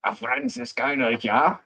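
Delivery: "Franziskaner" in a hammed German accent, pitched high and slow. Shown as a demonstration. Friend asks for a German accent on "Franziskaner". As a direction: hit "Franziskaner" like a German barman. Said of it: "then I can say it with the accent".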